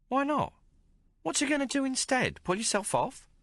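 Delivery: a streetwise barmaid teasing the hero; Maggie's voice. Acted. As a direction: play her worldly and mocking. Maggie taunts Hawk.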